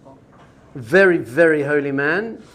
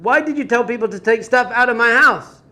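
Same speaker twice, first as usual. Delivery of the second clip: high, raised-voice accusation; retold anger; 5 semitones higher, 4 dB louder than usual. Villager accusing the rebbe over his furniture, angry. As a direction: demand an answer in acted indignation.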